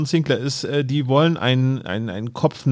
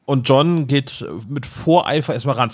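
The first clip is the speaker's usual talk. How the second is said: ordinary delivery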